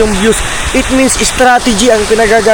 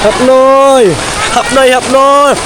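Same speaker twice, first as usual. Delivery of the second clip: calling out; 6 semitones higher, slower than usual. Real, not acted. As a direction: call across the yard. Calling out across the yard.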